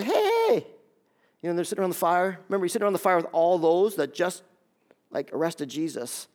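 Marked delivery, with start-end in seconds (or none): 0.0-0.7 high-pitched drawn-out "hey"; servant girl. Acted. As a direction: call out high in a mock girl's voice. Voicing servant girl calling to Peter.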